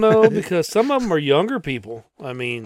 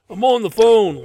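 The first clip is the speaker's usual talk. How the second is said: loud, high, annoyed snap; annoyed retort; 7 semitones higher, 6 dB louder than usual. Retold or imitated. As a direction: snap back, irritated. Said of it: "They're like".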